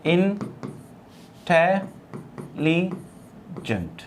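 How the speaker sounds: Intelligent broken into four slow syllables, in-te-li-gent. Shown as a demonstration. Teacher transcribes intelligent for students, syllable by syllable. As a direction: say intelligent softly, pausing after each syllable. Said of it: "यदि हम इसको transcribe करें तो हम कहेंगे".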